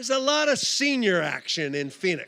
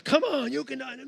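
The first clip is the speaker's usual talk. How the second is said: high-pitched pleading encouragement; imitated coaxing, pitched high; mock urging; 7 semitones higher than usual. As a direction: egg a tired friend on, voice way up. Retold urging, argument escalating.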